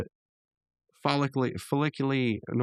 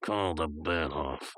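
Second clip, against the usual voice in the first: imitated chuckling elder; 5 semitones lower, 5 dB quieter, slower than usual. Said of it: "Skips kinda chuckles".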